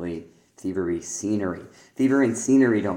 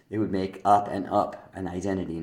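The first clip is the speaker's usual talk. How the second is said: usual speaking voice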